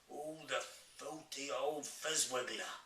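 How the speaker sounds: drawn-out giant exclamation, only half shouted; mild indignant shout. A narrator's reading. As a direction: curse a villain slowly, as an appalled giant. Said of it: "shouted the B F G".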